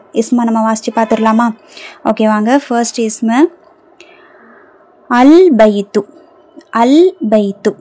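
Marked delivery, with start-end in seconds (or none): 5.1-7.8 "அல் பைத்து" with the L of அல் clearly sounded; lam pronounced. Shown as a demonstration. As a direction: slowly model "அல் பைத்து", holding a distinct L. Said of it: "லாம் உச்சரிக்கப்படணும்". ("அல் பைத்து" லாம் தெளிவாக உச்சரித்து)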